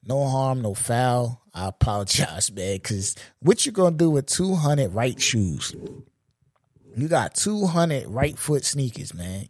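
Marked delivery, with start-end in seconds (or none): none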